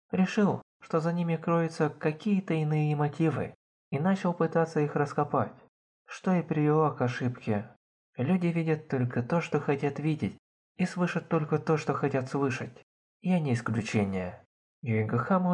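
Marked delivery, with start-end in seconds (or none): none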